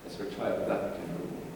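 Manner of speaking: ordinary delivery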